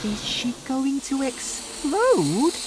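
very high, anxious inner question; wondering thought. A narrator's reading. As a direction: wonder it to yourself, pitched high. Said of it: "George thought".

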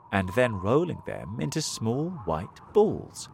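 ordinary delivery, nothing marked

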